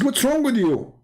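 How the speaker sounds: high-pitched imitation of an annoyed man; mock exasperation. Retold exasperated opponent.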